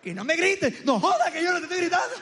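high, escalating quarrel, building to a yell; mock yelling. Comedian acts out couple's argument boiling over, high mock yelling. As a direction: imitate a couple yelling that they aren't yelling. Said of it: "Ya gritaste".

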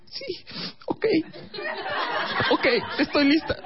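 mock whimpering, very high falsetto; tearful girlfriend. Girlfriend answering boyfriend that she is ready, mock tearful. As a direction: agree meekly in a tiny put-on female voice.

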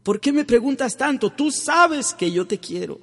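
high, loud insistent protest; Peter's protest. Retold or imitated. Peter protesting to Jesus he loves him, high insistent voice. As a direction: protest hurt but loving. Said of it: "Pedro le protestaba".

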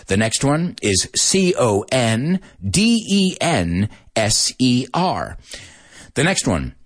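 speed-spell the letters; rushed letter-by-letter spelling; fast spelling. Shown as a demonstration. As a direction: rattle off letters quickly. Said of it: "I'm gonna repeat them, but at a more normal speed".

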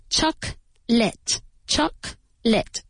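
"Chocolate" slowly split as CHOC, pause, LIT; careful syllable-by-syllable "Chocolate", said twice; two-syllable pronunciation. Shown as a demonstration. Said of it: "Careful with the pronunciation of chocolate".

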